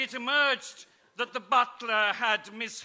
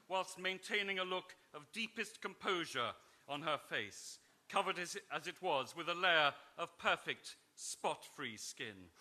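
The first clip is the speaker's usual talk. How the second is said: ordinary talk, nothing marked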